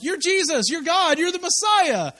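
lightly playing Peter's zealous outburst; eager declaration. Preacher voicing Peter to the congregation, mild eager excitement. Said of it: "he's the only one that was like".

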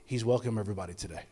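low, soft, quick translation; hushed interpreter aside. Retold or imitated. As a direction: lean in and translate under your breath. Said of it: "Sammy would lean over and go".

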